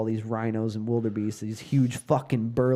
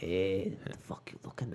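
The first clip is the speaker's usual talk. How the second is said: smiling tough guy; 7 semitones lower, 11 dB quieter than usual. Shown as a demonstration. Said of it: "There you go".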